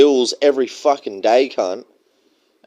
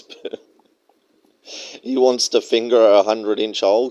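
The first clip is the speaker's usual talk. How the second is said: ordinary talk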